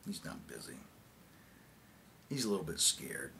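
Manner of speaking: low, soft and resigned; quiet bitter aside. Acted. Confiding why the president missed the launch, quietly bitter. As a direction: drop right down, letting each remark fall.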